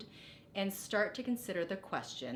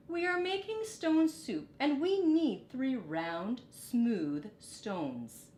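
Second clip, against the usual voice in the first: bright wise monk; 6 semitones higher, 5 dB louder, slower than usual. Acted. Sue explains to the girl.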